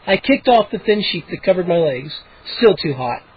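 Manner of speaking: usual speaking voice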